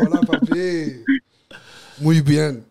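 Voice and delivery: put-on drawn-out Spanish accent. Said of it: "Paris can do a Spanish accent".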